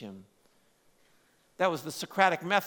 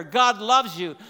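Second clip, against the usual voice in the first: louder, punching "loves" in "God loves you"; emphatic declaration; 3 semitones higher, 8 dB louder, slower than usual. Real, not acted. Preacher proclaiming God's love to the church, forcefully.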